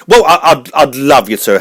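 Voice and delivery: normal talking voice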